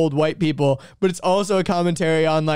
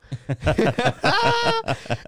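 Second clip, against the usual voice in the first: high laugh; 9 semitones higher than usual. Real, not acted. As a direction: burst of high-pitched laughter. Laughs after reciting joke.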